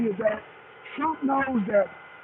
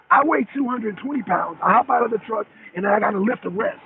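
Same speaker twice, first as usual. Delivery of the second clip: usual speaking voice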